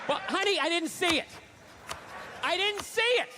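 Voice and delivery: high, heated, defensive ranting; hammed-up domestic squabble rant; mock argument. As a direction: argue back at your girlfriend, getting worked up. Acted fight with girlfriend.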